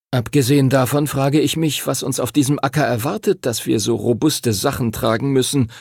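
plain reading voice

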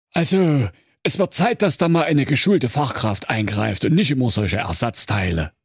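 a hint of a smug medic; brisk, self-assured expert; smug know-it-all. A narrator's reading. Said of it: "lachte und sprach". (selbstgefälliger Fachmann)

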